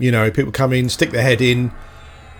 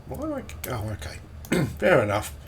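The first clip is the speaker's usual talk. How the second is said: normal talking voice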